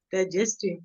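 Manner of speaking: normal talking voice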